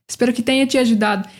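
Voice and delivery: "Espero que tenha te ajudado" rushed, run together at native speed; fast Portuguese phrase. Shown as a demonstration. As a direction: say "Espero que tenha te ajudado" quickly, all in one breath. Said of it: "put it all together and say it like she did".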